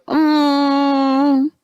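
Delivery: long, high, held "mmm"; hammed-up hum to the heavens; drawn-out high hum, mock-theatrical; imitated heavenward hum. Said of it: "looks up to the heavens and goes".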